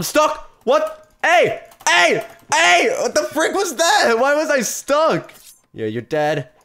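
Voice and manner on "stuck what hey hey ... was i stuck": high, loud, slowed outrage; indignant shout. Real, not acted. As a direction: yell in disbelief at being stuck. Gets stuck in game.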